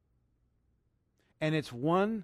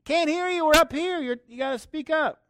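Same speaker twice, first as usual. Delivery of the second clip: high, loud taunting shout; calling down from above; 13 semitones higher, 8 dB louder than usual. Pretend. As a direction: holler down from a tower, playfully. Both looking down, calling to devil.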